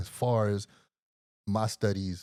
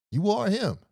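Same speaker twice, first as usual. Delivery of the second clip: excited exclamation; 11 semitones higher than usual. Retold or imitated. Narrator hails the doctor as a legend, high and excited. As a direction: cheer it out like meeting a hero.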